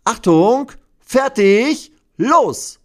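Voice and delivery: hoch und laut gerufen; Startkommando. Acted. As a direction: gib das Startsignal für alle. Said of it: "Dann sagt sie". (hohes, lautes Startkommando)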